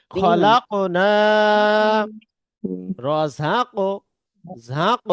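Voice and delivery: slow drawn-out practice recitation. Shown as a demonstration. Teacher leading slow practice of qalqala words. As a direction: read each word slowly with a bounce. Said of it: "আমরা সামনে যাচ্ছি".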